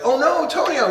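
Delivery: high mock alarm, Oh no Tonio; alarmed cry. Retelling the movie to friends, a high mock gasp of alarm. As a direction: yelp it up high like a cartoon panic.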